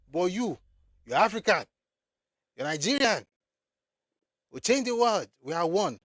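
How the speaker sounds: high, loud sarcastic chant of the slogan; mock the unity slogan with scorn. Mocking the pan-African slogan.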